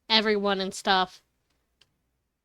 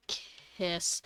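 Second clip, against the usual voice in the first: soft whispered kiss; 4 semitones lower, 12 dB quieter than usual. Real, not acted. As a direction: breathe it out unvoiced. Whispered to character.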